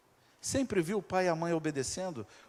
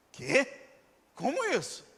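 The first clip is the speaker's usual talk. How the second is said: shocked king; 7 semitones higher than usual. Acted.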